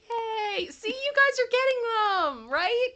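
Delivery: high bright praise; excited. Real, not acted. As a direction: cheer on the chat. Praising chat.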